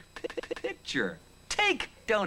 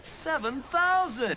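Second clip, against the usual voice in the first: slow, higher-pitched astonishment; amazed exclamation; 5 semitones higher, slower than usual. Acted. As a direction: stretch the number out in disbelief. Amazed at hot dog figure.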